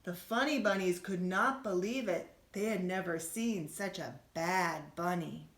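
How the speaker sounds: usual speaking voice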